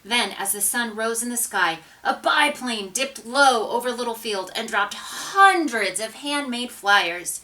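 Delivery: plain reading voice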